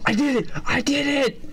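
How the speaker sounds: very high triumphant cry; overjoyed victory yell; excited. Real, not acted. Finally beats the level.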